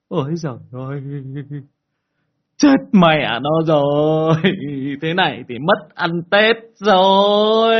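loud, high, drawn-out lament; mock tearful wail. Truck driver sees the boy under his truck, hammed-up wailing. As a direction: wail it out, stretching the last words way up high. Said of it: "ông lắc đầu mếu máo".

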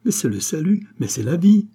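high, rising entreaty to the king; urgent pleading. A narrator's reading. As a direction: plead as if his life depends on it. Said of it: "supplia madame Campan". (supplication pressante, voix haute)

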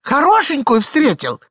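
high, loud, slow repetition; high-pitched exasperated woman; impatient wife. Acted. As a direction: spell it out for a doddering husband. Wife repeats for forgetful husband.

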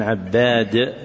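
عباد said ʿAbbad, short a and a held double b; fatha and doubled ba. Shown as a demonstration. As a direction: pronounce عباد as ʿab-BAAD, slowly. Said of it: "بفتح العين والباء مشددة".

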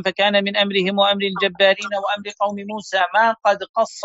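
scholarly read-aloud with fully voiced endings; formal text reading. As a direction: read the text aloud in careful classical Arabic. Reads a classical text aloud.

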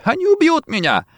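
very high wail; terrified, tearful cry at the top of the voice; sobbing. A narrator's reading. Rossetti fears his killers, wailing. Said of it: "плакал, всхлипывая Рассетти".

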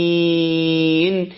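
العالمين chanted with a long held "ee"; elongated madd. Shown as a demonstration. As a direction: stretch the last syllable of العالمين into its n. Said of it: "عندي هنا مد بسبب السكون".

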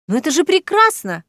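a lifted, eager exclamation; exclaiming. A narrator's reading. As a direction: exclaim it with rising pitch. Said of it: "воскликнула гостья".

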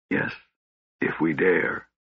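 soft, low, hesitant answer; faint reply. A narrator's reading. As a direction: let the answer come out faintly. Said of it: "Then faintly he replied".

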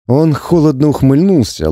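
usual speaking voice

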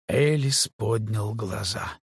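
ordinary delivery, nothing marked

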